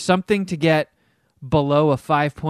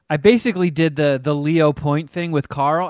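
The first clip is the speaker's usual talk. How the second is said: ordinary delivery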